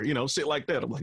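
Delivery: normal talking voice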